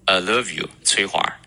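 put-on American-accented love as a relaxed "luhv"; American English, short uh in love, then the name; American confession. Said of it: "如果表白的是个美国人".

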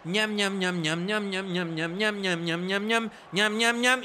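quick repeated "ñam" nonsense noise; playful "ñam-ñam" babble. Pretend. Commentator mimicking two players reading each other's minds, playful babble. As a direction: chatter "ñam, ñam" in a steady run. Imitates wordless exchange between players.

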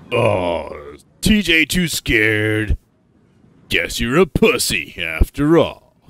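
loud, jeering baby-talk mockery; sneering public tease; mocking taunt. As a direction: draw it out loudly to humiliate the scared one. Flynn taunts scared TJ from line.

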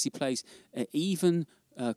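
normal talking voice

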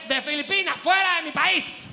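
hammed-up angry contestant; mock outburst. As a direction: snap at him like an offended beauty queen. Mock contestant snapping at asker.

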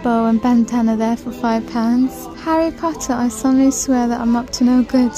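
ordinary delivery

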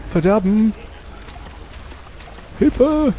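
high loud scream for help; panicked cry. Real, not acted. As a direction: shriek it in panic.

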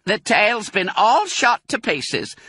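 high, loud and slow; Mrs Bean voice. A narrator's reading. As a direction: call it down, scornful. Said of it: "said the voice from upstairs".

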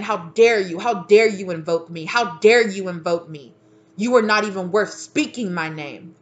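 loud, indignant rebuke; mad ancestors. Acted. Ancestors scolding someone who invoked them, loudly indignant. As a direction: channel outraged ancestors, punching the words loudly. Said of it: "somebody's ancestors are mad. They just keep saying".

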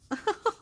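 high squealing giggle; squeal of laughter. Real, not acted. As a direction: squeal with delight. Overjoyed at journal page.

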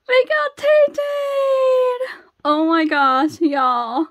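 high, loud, stretched-out squeal easing off; overjoyed squeal. Real, not acted. Fan tells y'all she got [name], overjoyed squeal.

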